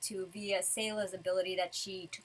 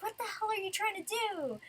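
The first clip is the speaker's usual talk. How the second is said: exasperated mock demand; 11 semitones higher than usual. Natsu challenges the giant woman trapping them, exasperated. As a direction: voice his disbelief high and light.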